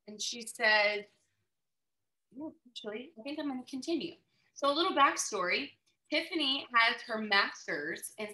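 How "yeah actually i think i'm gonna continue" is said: soft, hesitant answer; quiet reply. Retold or imitated. Wife tells her husband she'll keep going, quiet and tentative. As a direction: say it quietly, half to yourself.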